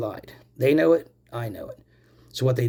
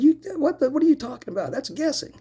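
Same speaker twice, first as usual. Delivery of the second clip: retelling his outraged reply to the trooper, much higher; incredulous outburst; 12 semitones higher than usual. Retold or imitated. Quoting himself to Trooper Brown in high incredulous disbelief. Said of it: "And I said".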